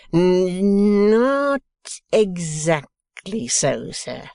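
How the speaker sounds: a loud start, then slow and prim; prim correction. A narrator's reading. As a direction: Wegg correcting Boffin, stuttered and slow. Wegg correcting.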